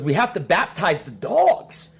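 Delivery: hammed-up urgent demand, slow and high; mock frantic urgency. Wife insisting to husband about the dogs, mock frantic.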